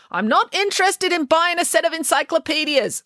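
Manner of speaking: high-pitched, brusque refusal at the door; dismissive householder. A narrator's reading. Householder to strangers knocking at the door, dismissive. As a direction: turn the callers away in a high voice.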